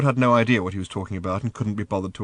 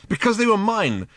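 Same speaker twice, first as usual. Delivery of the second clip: crossly; 14 semitones higher, 6 dB louder than usual. A narrator's reading. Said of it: "said Ford crossly".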